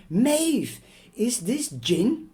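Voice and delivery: high, loud shocked demand; mother catching her daughter with gin; shocked accusation. Acted. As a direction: call her out in disbelief.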